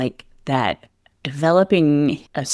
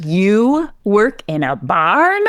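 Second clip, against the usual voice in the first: higher, slowed, incredulous "You work in a barn?"; amused disbelief; 6 semitones higher, slower than usual. Retold or imitated. Barber in disbelief at a horse trainer working in a barn, slow incredulous rise. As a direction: stretch it out, amazed and laughing at it. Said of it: "He's like".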